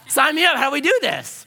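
high, loud eagerness; eager enthusiasm. Retold or imitated. Twenty-year-old wanting in on the scheme, excited. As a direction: jump at it like a keen youngster.

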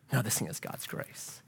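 breathy whisper of awe; whispered repeat. Real, not acted. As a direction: whisper it the second time.

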